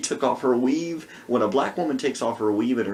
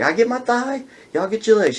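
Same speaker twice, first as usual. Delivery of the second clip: high sassy woman; 8 semitones higher, 4 dB louder than usual. Retold or imitated. Woman voiced.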